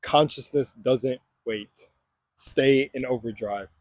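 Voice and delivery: slow, weighty motto delivery; slow slogan read. Acted. Vlogger opening his video with a motto, slow and weighty. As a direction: lay each word down slowly like a motto.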